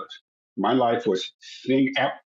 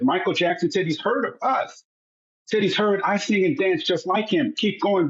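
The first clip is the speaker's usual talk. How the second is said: higher, thrilled retelling of the call; excited; 6 semitones higher than usual. Retold or imitated. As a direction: brother telling the family after hanging up, excited and high. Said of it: "all excited".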